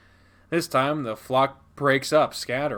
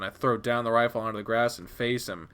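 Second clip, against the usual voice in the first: ordinary delivery, nothing marked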